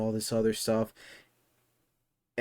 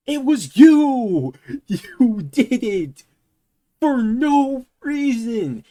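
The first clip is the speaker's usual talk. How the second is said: slow, high shouting with a laugh; outraged shout; 10 semitones higher, 8 dB louder, much slower than usual. Real, not acted. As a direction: yell it out in disbelief. Ranting at the scammer's excuse.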